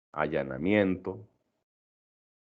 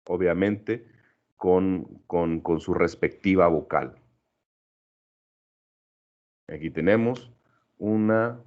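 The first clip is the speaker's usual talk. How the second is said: ordinary talk, nothing marked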